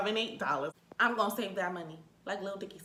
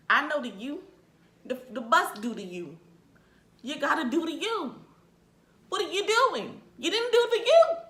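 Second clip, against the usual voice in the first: rising higher and higher in exasperated protest; 8 semitones higher, 6 dB louder than usual. Retold or imitated. Passenger scolding driver for skipping the U-turn, exasperated. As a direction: argue back, building to a loud, high complaint.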